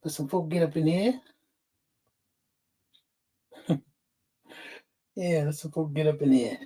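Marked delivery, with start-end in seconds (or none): none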